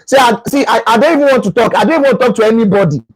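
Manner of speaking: snappy fast grumble, no time for anyone; irritated brush-off. Acted. Irritated partner cutting off the caller. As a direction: blow someone off quickly. Irritated reply, acted scene.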